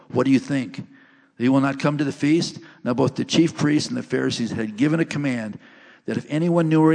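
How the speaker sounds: loud, measured Bible reading from the pulpit; scripture reading. Acted. Pastor reads John's gospel to the congregation, loud proclaiming read.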